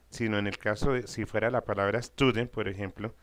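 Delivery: normal talking voice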